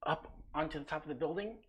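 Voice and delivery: ordinary delivery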